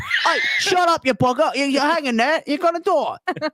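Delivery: high, shouted British bully voice; angry executioner. Retold or imitated. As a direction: bark like a rough hangman, high. Mock hangman yelling.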